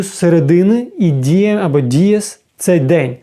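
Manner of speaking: ordinary talk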